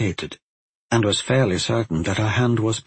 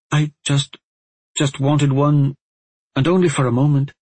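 pleading excuse; 6 semitones higher, 4 dB louder than usual. A narrator's reading. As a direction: plead haltingly with the elder, voice raised. Girl pleads her excuse to elder.

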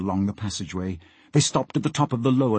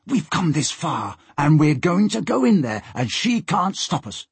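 higher, heated defiance; furious; 6 semitones higher than usual. A narrator's reading. Peter defies Molly at the hold door, furious. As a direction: insist angrily, pitch climbing.

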